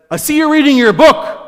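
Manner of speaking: high, loud, stern official tone; mock stern woman warden. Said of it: "she talked like that".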